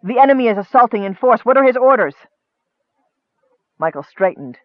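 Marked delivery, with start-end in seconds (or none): 0.0-2.2 urgent, raised-pitch battle report; pressed, harsh soldier's urgency; harsh demand. A narrator's reading. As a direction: report forcefully and demand orders. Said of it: "he demanded harshly".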